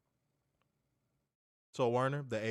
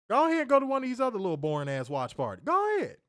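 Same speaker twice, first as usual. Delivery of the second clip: higher, fast mocking dare; hyped taunt; 11 semitones higher, 4 dB louder, faster than usual. Real, not acted. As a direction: dare them to go elsewhere. Hyping his watch party.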